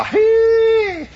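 long high holler at the dogs; drawn-out coon-hunter's cry. Retold or imitated. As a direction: call the hounds on across the swamp. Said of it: "would holler".

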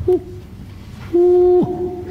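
high whooping call; distant bunyip reply. Retold or imitated. Hunter imitates the bunyip answering far off, high whoops. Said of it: "I heard far off in the distance".

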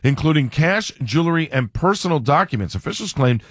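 steady radio newscast delivery; news read. Acted. Reads the headline news.

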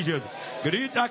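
ordinary talk, nothing marked

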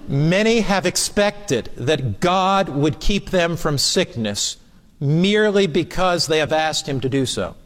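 slow, deliberate reading aloud. Real, not acted. Preacher reading a powerful statement to listeners, slowly. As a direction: read it slowly, giving each phrase weight. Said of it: "I'll read it slowly".

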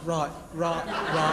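slurred low-class British "right"; low-class British accent. Shown as a demonstration. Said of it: "we can go to low-class British".

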